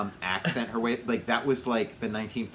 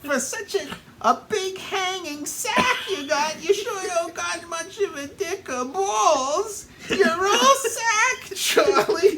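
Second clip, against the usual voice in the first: very high taunting voice, louder at end; shrill mocking falsetto; 3 dB louder than usual. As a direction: screech the insults like a cartoon wife. Falsetto insults.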